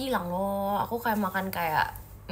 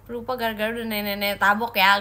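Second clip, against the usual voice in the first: usual speaking voice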